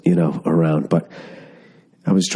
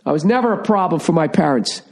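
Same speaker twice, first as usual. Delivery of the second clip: higher, sweet fake-innocent voice; mock innocent kid; 11 semitones higher than usual. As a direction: lay it on like a kid fibbing to look perfect. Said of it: "lie about what you did when you were a kid".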